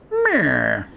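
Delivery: lightly voiced scheming villain; a hint of a sneering villain; mustache twirling villain. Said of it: "That's how he sounds".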